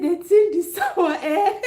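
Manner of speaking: slow, high, laughing through the husband's words; retelling husband, giggling; laughing quote. Retold or imitated. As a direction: quote him while cracking up. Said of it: "He said".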